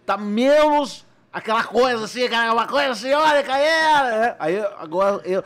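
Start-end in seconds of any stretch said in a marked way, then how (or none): 0.1-4.3 caricatura de humorista agitado; voz alta e aguda, subindo até o fim; Porchat. Shown as a demonstration. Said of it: "pegar o jeito mesmo dele de falar". (imitação exagerada, elétrica)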